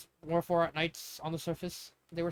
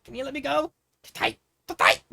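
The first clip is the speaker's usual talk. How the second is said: squeezed breathless; 8 semitones higher, 6 dB louder than usual. Acted. Squeezed in a tight hug.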